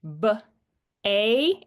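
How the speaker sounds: "B, A" as separate sounds, "buh ... ay"; sounding out for spelling. Shown as a demonstration. Student spelling bacon, slow separate "buh", "ay". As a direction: isolate B, then a long A, with a pause. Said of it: "When they go to spell words".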